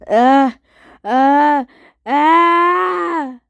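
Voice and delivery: sneeze build-up. Acted. Character about to sneeze.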